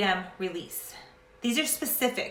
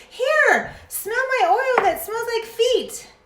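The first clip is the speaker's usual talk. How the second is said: much higher, louder silly voice; mock pushy friend; 13 semitones higher, 6 dB louder, slower than usual. Clueless friend offering smelly oil, high silly voice.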